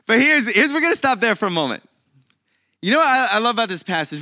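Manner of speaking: loud, quick, eager lead-in; excited burst. Real, not acted. Pastor rallying the congregation, excited and loud. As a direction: grab the room with sudden energy.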